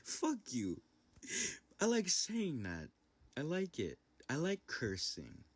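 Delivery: high, giddy, laugh-charged outburst; gleeful cursing. Real, not acted. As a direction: relish it, pitched high after a cackle. Gleeful cursing between laughing fits.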